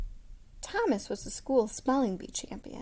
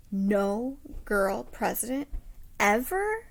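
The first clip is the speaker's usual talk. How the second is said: put-on incredulous girl; 4 dB louder, slower than usual. Grace learns there's never been one.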